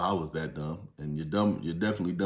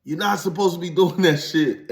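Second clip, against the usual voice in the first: ordinary talk, nothing marked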